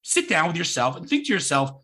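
ordinary talk, nothing marked

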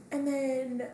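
normal talking voice

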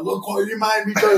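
high whiny blaming voice; mock accusing whine at his mates. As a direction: pin the blame on them, half-joking. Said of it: "like, blaming them".

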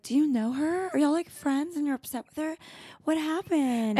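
high-pitched innocent concern; mock-sweet oblivious questioning; acting dumb. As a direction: ask with feigned, wide-open naivety. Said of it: "Like, acting dumb".

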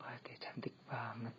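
breathless whispered admiration. Pretend. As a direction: whisper it like a secret crush. Obsessive inner thoughts, whispered. (berbisik kagum)

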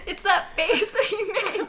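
giggling through the words; laughing. Real, not acted. Friend laughing about the actor's face, laughing.

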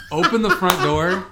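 high, loud and slow through laughter; words bursting out on a laugh; laughing while talking. Real, not acted. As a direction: crack up while telling it. Breaks up laughing at the memory.

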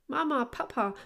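urgent little child's call, lightly acted; excited. A narrator's reading. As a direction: call out eagerly for Mum and Dad. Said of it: "ganz aufgeregt und außer Atem".

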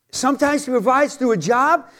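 ordinary delivery

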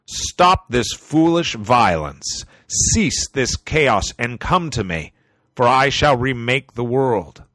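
slow, loud decree; commanding god voice. Acted. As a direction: proclaim it like an almighty wizard. The Majestic One commands the world.

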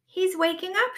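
high, loud storybook rabbit shout; excited shout. Acted. Rabbit announces Robot waking up, excited shout. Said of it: "shouted Rabbit".

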